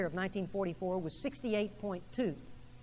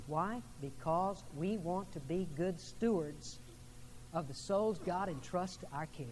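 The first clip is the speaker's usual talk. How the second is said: ordinary talk, nothing marked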